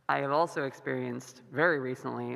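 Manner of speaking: ordinary talk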